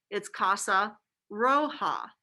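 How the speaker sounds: "roja" stretched out slow, Spanish r-o-h-a; casa roja said slowly. Shown as a demonstration.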